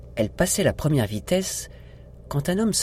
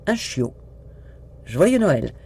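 excited exclamation; 6 semitones higher, slower than usual. Acted. Carl gives Tony a puppy for Christmas, exclaiming excitedly. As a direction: announce the puppy like a happy surprise. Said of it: "s'exclama-t-il".

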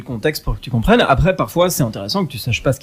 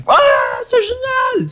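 hyper-excited; slower than usual. Acted. Voice actor imitating an over-excited cartoon character, hyper-excited squeal. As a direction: shriek with over-the-top enthusiasm. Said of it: "qui sont hyper excités comme ça".

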